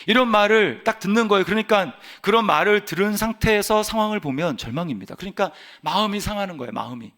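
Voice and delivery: usual speaking voice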